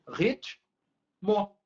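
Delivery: Ritmo as rit, long pause, mo; split into syllables. Shown as a demonstration. Teacher shows the division, Ritmo in two detached syllables. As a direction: break Ritmo after the t. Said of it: "Essa fica a separação".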